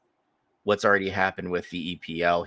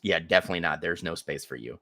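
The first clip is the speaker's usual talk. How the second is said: ordinary delivery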